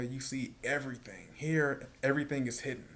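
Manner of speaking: normal talking voice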